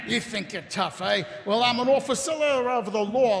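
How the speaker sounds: normal talking voice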